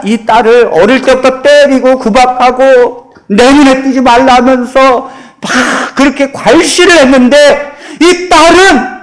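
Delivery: ordinary talk, nothing marked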